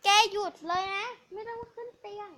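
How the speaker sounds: scolding. Real, not acted. Scolding the dog.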